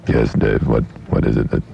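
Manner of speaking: put-on low, droning German-accented statesman; Henry Kissinger. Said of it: "let me find Henry Kissinger".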